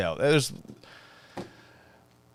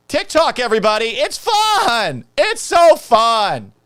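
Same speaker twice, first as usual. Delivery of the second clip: much higher, louder fake cheer; mock hype; 11 semitones higher, 7 dB louder, slower than usual. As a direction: hype it up sarcastically.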